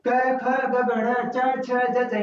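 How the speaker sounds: letters rattled off in one quick breath; run together like children. Shown as a demonstration. Teacher showing how children rattle off the letters in a run. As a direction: recite क ख ग in one continuous run, as children chant it. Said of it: "बच्चे इस तरीके से बोलते हैं".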